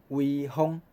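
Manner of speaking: usual speaking voice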